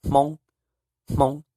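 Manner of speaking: "mong" high with breathy nasal start; teacher saying the word for Hmong; Hmong hmoob. Shown as a demonstration. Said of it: "which is".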